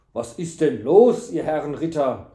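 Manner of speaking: loud, alarmed question; aghast. A narrator's reading. Innkeeper walks in on the scuffle, aghast. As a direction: call out in dismay. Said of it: "rief er entsetzt".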